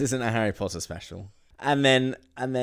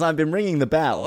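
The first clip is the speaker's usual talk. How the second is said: higher and louder, fussy grievance; indignant complaint; 8 semitones higher, 8 dB louder than usual. Retold or imitated. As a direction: complain like a put-out old toff. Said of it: "He says".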